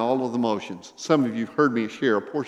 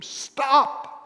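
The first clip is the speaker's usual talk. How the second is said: high, sudden cry imitating her scream; retold scream; 4 dB louder than usual. Lady in wheelchair to passing preacher, imitated scream. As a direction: re-enact her piercing command to halt. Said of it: "it was a scream".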